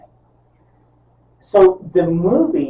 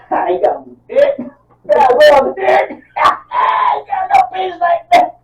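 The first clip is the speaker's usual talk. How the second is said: high, loud imitated taunt through laughter; mocking laughter; 8 dB louder, slower than usual. Wife laughing at the naked cult leader, mocking. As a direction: mimic hysterical, jeering laughter as you shout. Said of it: "she starts laughing".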